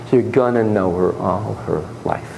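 ordinary delivery

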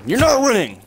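high, loud mocking shout; shrieking taunt at the enemy; taunting yell. Real, not acted. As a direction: jeer at the loser.

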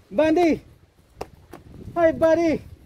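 high, bright call to a friendly dog. Real, not acted. Calling to a passing dog. (masayang pagtawag sa aso)

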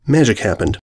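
ordinary delivery

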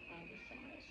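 whispered aside; breathy hushed whisper to self. Real, not acted.

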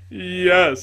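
high, loud, sneering yes; bitter retort. A narrator's reading. Tragedian bitterly answering the lady, loud sneer. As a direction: spit it back at her.

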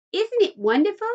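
put-on high, delighted child's exclamation; excited cry. A narrator's reading. Charlie tastes the minty grass, excited high cry. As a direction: marvel at it like a thrilled boy. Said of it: "cried Charlie".

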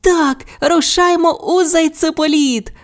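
very high, gleeful little character voice; high-pitched delighted call; joyful Bu. A narrator's reading. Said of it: "раділа Бу".

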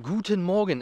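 ordinary delivery

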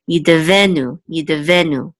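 yidevenu with the weight on ve; stress middle syllable. Shown as a demonstration. Teacher showing the accent on yidevenu. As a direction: lean on ve in yidevenu. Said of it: "the accent mark is in this middle syllable".

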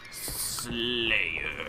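low, drawn-out announcer imitation; low, hushed mock announcer; game announcer voice. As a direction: intone it softly like an announcer. Echoing the game mode announcer.

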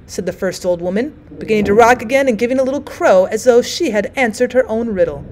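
ordinary delivery

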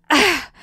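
exhausted gasp after the pain; panting sigh. Acted. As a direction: let the breath rush out. After the leg rips off.

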